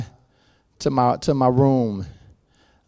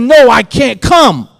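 higher, louder, slower cry of no; shouted refusal; 9 semitones higher, 10 dB louder, slower than usual. Retold or imitated. Refusing the dead stepmother in a dream, shouted. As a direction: refuse her with full force.

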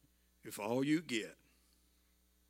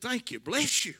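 a higher, louder voice for the worker's heartfelt thanks; grateful man's thanks; 10 semitones higher, 6 dB louder than usual. Retold or imitated. Tired laborer thanking the stranger, raised and grateful. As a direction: give the tired laborer's bright thank-you.

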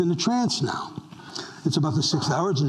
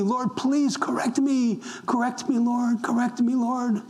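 earnest prayer; 5 semitones higher than usual. Acted. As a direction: pray it from the heart, begging for change. Pastor voices earnest prayer.

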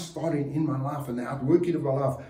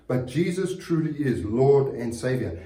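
ordinary talk

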